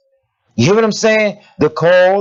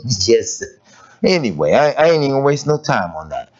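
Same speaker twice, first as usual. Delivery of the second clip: ordinary delivery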